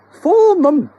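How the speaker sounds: very high, loud wife voice; wife scolding her husband; bossy wife. Acted. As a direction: order him about. Wife's voice.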